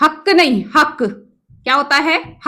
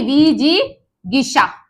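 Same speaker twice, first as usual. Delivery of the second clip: विजिगिसा slowly, the गि clearly sounded; slow correct form; much slower than usual. Shown as a demonstration. (धीरे-धीरे सही: विजिगिसा)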